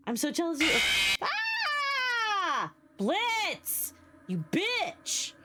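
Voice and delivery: high-pitched playful shriek of envy; hammed-up jealous squeal, drawn out; mock jealous outburst. Streamer teasing Blitz over jealousy, mock jealous shriek.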